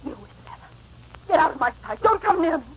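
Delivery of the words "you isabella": whispered, hissed; breathy menacing hiss. Acted. Catherine turns on Isabella.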